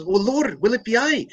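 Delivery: high Irish lilt on "will it be I"; Irish John. Retold or imitated. John asks Jesus in the priest's Irish brogue. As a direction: ask in a thick Irish brogue. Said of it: "John comes up and says".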